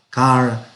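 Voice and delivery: "car" with a soft, untrilled English r. Shown as a demonstration. Teacher giving an example of English R, soft r in "car". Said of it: "que es suave siempre".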